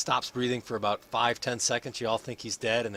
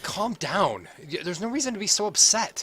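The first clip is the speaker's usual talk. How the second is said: higher, a little louder, lightly voiced and puzzled; flustered innkeeper; 8 semitones higher, 6 dB louder than usual. Innkeeper soothing the upset dwarf, higher and puzzled. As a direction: try to calm an angry guest.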